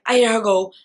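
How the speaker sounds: ordinary talk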